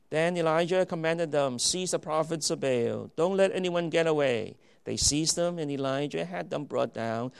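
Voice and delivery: loud, ringing scripture reading; thundering preacher reading the command; preaching at full force. Acted. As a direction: proclaim it to the back row.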